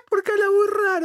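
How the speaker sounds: high squeaky laughing voice; giggling high. Real, not acted.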